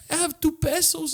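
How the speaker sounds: high, lightly voiced broke ghost. A broke ghost offering two pesos, squeaky. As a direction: plead poverty in a squeaky voice. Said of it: "they're like".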